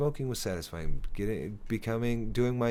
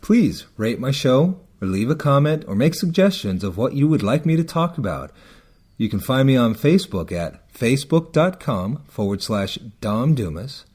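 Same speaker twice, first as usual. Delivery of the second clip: louder, announcer-style closing plug; podcast outro read; 2 semitones higher, 11 dB louder than usual. Acted. Host signing off his podcast to listeners, announcer read. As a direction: read the sign-off like a show announcer.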